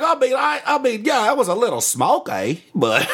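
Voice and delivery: high, conceding mock Australian; Australian accent. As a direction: grudgingly admit it as a hammed-up Aussie. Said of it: "One of those dudes from Australia".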